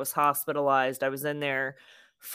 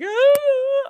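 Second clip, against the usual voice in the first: high, loud retold shriek; imitated scream; 9 dB louder than usual. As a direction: mimic a sudden terrified scream. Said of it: "start screaming, screaming bloody murder".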